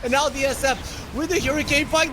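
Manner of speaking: high, loud hype call; excited play-by-play. Acted. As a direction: call the fight at full pitch. Play-by-play of final fight.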